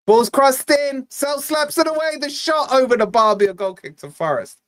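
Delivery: mock live football commentary; play-by-play. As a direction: call the cross like a match commentator.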